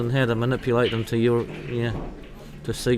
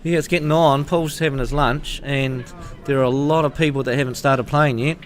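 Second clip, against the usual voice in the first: ordinary talk, nothing marked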